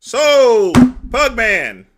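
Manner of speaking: loud call across the room. Real, not acted. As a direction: shout the name to get his attention. Calling out to a competitor.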